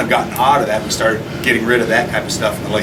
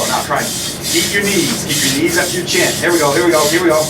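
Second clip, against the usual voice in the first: aerobics instructor; 6 semitones higher than usual. Real, not acted. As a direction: drive him through the last seconds. Coach cheering the second burst.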